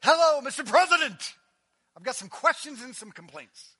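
loud, eager caller to the president; mock phone call. Preacher pretending to phone the president, loud and eager. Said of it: "Give me a phone".

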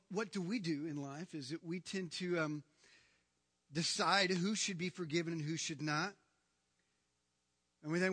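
ordinary delivery

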